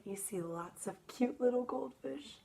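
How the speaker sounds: usual speaking voice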